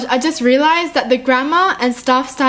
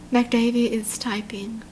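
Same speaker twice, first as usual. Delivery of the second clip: slow, suspenseful whisper; hushed whisper; 2 semitones lower, 8 dB quieter than usual. Real, not acted. Reader reading out that McDavie is typing, hushed whisper.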